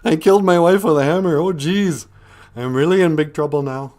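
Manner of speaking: loud, high mock panic in a thick Minnesota accent; panicked Minnesota husband. Husband who killed his wife, in loud, high mock panic with a Minnesota accent. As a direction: wail Oh jeez like a Hollywood Minnesotan. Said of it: "he's like".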